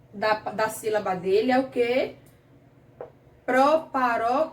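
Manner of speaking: ordinary talk, nothing marked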